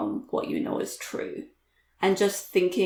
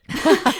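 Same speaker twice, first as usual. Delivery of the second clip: bright laugh; 9 semitones higher, 8 dB louder than usual. Real, not acted. Laughing at mismatched outfits.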